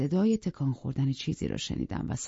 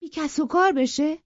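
angry echo; 12 semitones higher, 6 dB louder than usual. Acted. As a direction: throw the word back at her in outrage. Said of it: "با عصبانیت گفت".

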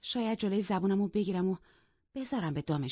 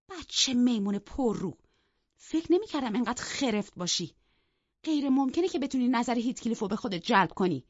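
raised, incredulous scolding; astonished; 6 semitones higher, 4 dB louder than usual. A narrator's reading. Sister-in-law hearing Isabella's confession of love, astonished. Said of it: "خانم لینتون با حیرت گفت".

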